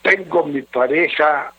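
low, subdued letter-reading voice. Acted. Radio adviser reading a troubled husband's letter, low and measured. Reads listener's letter aloud.

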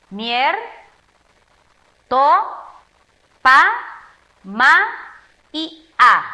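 syllable by syllable, as if clapping; syllables clapped apart. Shown as a demonstration. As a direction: split the word into syllables with pauses. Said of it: "Separamos con las palmas".

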